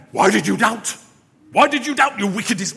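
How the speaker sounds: loud, thundering accusation; angry rebuke. Acted. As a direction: roar it as a furious telling-off. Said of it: "like, anger in Jesus".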